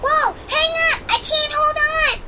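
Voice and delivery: clinging on in fear; frightened. Acted. Dog slipping down icy slope.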